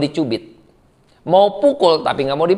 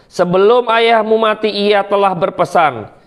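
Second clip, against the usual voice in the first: scripture reading. Acted. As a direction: read the passage solemnly from the pulpit. Reads scripture passage aloud.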